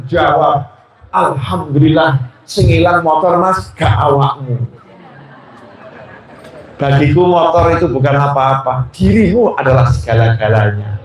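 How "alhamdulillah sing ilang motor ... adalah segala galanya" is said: tender wife. Acted. Pious wife soothes husband.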